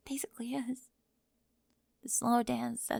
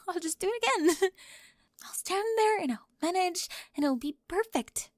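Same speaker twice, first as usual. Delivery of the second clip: higher and louder, cracking; voice rising, near tears; 6 semitones higher, 5 dB louder than usual. Acted. Spring confessing to Raven, voice climbing toward tears. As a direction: push up toward breaking. Voice breaking in distress.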